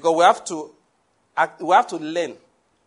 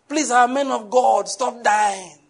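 raised, slow mock plea; hammed-up preaching appeal breaking into amusement; imitated zealous preacher; 5 semitones higher, 4 dB louder, slower than usual. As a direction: implore them like an overzealous preacher. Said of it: "talking with a lot of zeal".

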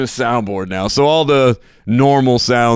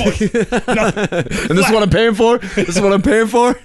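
mock indignant; 6 semitones higher than usual. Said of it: "he's like".

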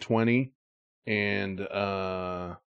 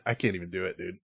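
normal talking voice

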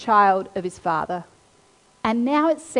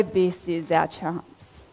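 much lower, softer, very slow plotting line; sinister plotting; 6 semitones lower, 7 dB quieter, much slower than usual. Retold or imitated. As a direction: murmur it like conspirators. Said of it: "the brothers go".

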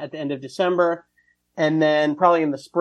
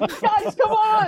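high squealed mock wife, laughing; imitated excited wife; slower than usual. Comic playing the wife gathering people for the joke, squealed impression. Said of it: "your wife was like".

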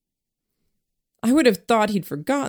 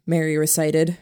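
ordinary delivery

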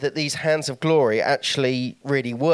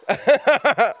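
bright, higher, louder burst of laughter; laugh at own grim joke; 10 semitones higher, 5 dB louder than usual. Real, not acted.

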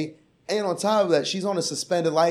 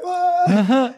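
panicked yell; 11 semitones higher, 6 dB louder than usual. Retold or imitated. Panic from the back seat.

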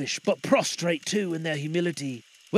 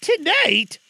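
high, loud startled cry; 12 semitones higher, 8 dB louder than usual. Acted. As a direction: shoot up in alarm. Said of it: "cried Scrooge".

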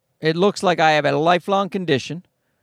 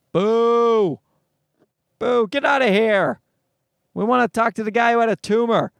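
mock booing crowd; 5 semitones higher than usual. Crowd booing the rickets guy.

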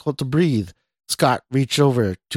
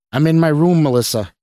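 louder, higher call to someone in another room; 5 semitones higher, 6 dB louder than usual. A narrator's reading. Scott answers his girlfriend from his room, calling out. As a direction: raise the voice to be heard down the hall. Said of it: "Scott called out".

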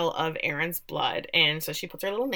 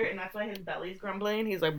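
normal talking voice

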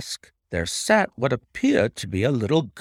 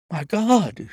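high, loud cry of shock; loud high horrified exclamation; 7 semitones higher, 5 dB louder than usual. A narrator's reading. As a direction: gasp it out in horror. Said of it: "he cried".

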